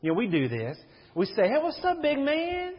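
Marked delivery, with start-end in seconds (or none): 1.5-2.7 much higher, playful big-man greeting; high, playful hey what's up big man; buddy greeting to a kid. Retold or imitated. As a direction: greet a little fella like a big shot. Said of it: "We say that to these guys".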